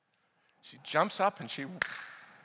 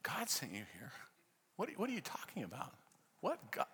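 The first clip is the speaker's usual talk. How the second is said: hushed, incredulous questioning; baffled student; 3 semitones lower, 11 dB quieter than usual. Retold or imitated. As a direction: imitate a bewildered student, much softer. Imitating a baffled atheist student.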